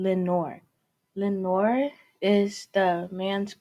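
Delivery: normal talking voice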